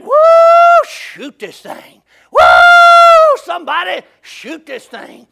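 piercing long whoops, then drawn-out plea; storyteller imitating a man howling from a tree; retold holler. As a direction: scream for help between slow, stretched words. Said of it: "he hollered down at the ground".

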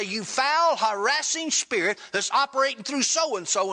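acted-out exorcism order; retold command. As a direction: rebuke an evil spirit with authority. Acted command to an evil spirit.

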